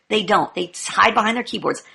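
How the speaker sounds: ordinary delivery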